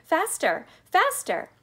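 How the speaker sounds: high, loud repeated command, rising in pitch; escalating shouts; urgent cry. A narrator's reading. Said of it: "cried Mister Wonka".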